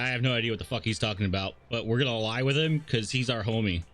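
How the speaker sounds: usual speaking voice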